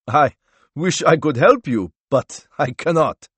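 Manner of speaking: cornered man declining, loudly; emphatic refusal. A narrator's reading. Accused man refuses to help.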